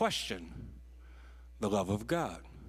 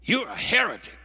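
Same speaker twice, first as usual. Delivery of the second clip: loud, much higher accusing shout; angry students; 8 semitones higher, 8 dB louder than usual. Retold or imitated. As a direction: hurl it at the professor.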